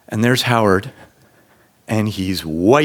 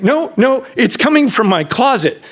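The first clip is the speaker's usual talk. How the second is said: panicked; 12 semitones higher than usual. Retold or imitated. Panicking about the noise.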